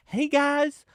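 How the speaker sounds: high-pitched imitation of a nurse; retold nurse in a high voice; nurse calming patients. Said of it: "another nurse came out to calm him down".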